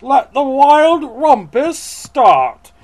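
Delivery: high, loud, slow proclamation; drawn-out theatrical shout; crying out. A narrator's reading. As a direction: proclaim it like a new king. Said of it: "cried Max".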